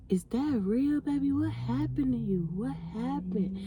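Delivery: soft, slow, hushed worried asking; a crackhead murmuring her concern. Retold or imitated. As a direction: lean in and ask quietly, slowing down. Crackhead whispering concerned question.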